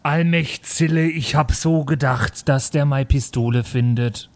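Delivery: a light Bavarian dialect voice, slow; playing a Bavarian with a hint of dialect; Bavarian accent. Bavarian character worrying about his pistol.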